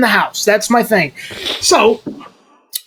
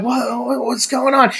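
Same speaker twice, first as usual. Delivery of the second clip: retold bafflement; 6 dB quieter than usual. Retells his baffled reaction to cops.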